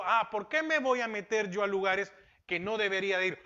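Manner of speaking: normal talking voice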